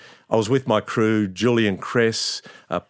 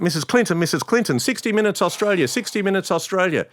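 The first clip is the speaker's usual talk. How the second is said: higher, calling across the crowd; 9 semitones higher than usual. Retold or imitated. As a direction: call out to the senator over the barriers. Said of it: "I'm screaming out".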